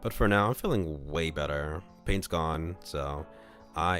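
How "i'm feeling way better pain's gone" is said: normal talking voice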